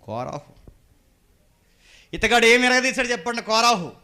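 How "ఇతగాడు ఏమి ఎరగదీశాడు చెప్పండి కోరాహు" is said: high, loud preaching question; full-force challenge. Acted. Preacher asking what Korah did, loudly challenging. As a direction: throw the question out loudly to the congregation.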